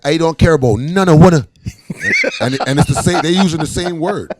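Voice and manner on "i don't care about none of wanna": put-on loud, high Bajan accent; imitating a Bajan brushing people off, Barbados accent. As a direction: Barbados accent, pitched up and belted. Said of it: "Bajans would be like".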